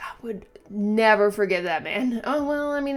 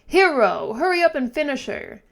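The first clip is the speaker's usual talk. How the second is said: loud, higher urging call; urgent shout; 8 semitones higher, 7 dB louder than usual. Acted. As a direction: push the hero to act now. Villager urges the hero.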